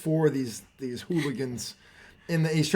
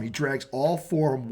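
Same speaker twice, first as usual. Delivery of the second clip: ordinary talk, nothing marked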